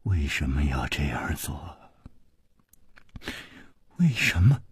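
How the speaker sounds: low, very soft, dazed; hushed, wounded bewilderment; murmuring. Acted. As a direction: murmur it numbly with a long pause. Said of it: "喃喃地说".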